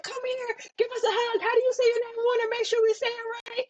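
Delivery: high, sweet, gushing voice of eager relatives; welcoming relatives. Retold or imitated. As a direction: coo it like relatives smothering a newcomer with love. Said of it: "they were like".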